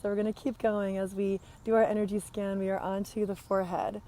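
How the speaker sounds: normal talking voice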